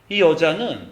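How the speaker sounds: ordinary delivery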